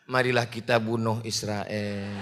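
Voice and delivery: mock-gentle, very soft and low; lemah lembut dibuat-buat. Shown as a demonstration. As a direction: say a battle cry as meekly as possible. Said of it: "mau ngomongnya lemah lembut". (suara halus rendah, pura-pura lemah lembut)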